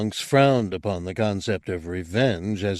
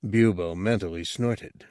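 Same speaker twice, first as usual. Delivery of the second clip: plain reading voice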